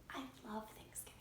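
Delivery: soft, fast whisper of thanks; whispered prayer. Acted. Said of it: "said a little prayer".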